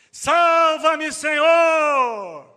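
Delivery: high, loud cry for rescue; desperate shout. Acted. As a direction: scream for help as you sink. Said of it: "Ele gritou, hein?".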